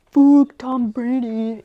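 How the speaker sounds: put-on loud, slow Boston accent jab; hammed-up Boston accent, dropped R's. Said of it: "There's no R's in the Boston accent".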